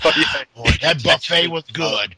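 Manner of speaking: fat exec voice. Pretend. Mocking a fat exec after eating.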